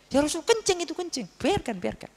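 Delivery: mock alarm at the urinating Bedouin; upset companion. Companion protests to the Prophet. (sahabat yang protes, suara lebih tinggi, agak dibuat-buat)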